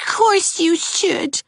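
put-on high boy's voice, scolding; a shivering child blaming his teacher; high, whiny boy blaming Miss Shirley; chattering reproach. A narrator's reading. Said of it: "said Geraldine".

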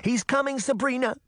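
very high, alarmed cry; urgent warning. A narrator's reading. Fanny alerts Sabrina to the villain, urgently warning. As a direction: warn in a high, pressing voice. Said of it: "Fanny warned".

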